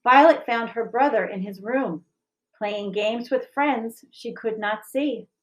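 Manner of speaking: gentle picture-book reading, even and clear; story narration. A narrator's reading. Teacher reading a picture book to the school, gentle narration. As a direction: read it like storytime for children.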